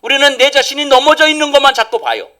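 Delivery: loud, high, driving sermon delivery; rising pulpit fervour; forceful preaching. Acted. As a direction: preach it out to the whole church.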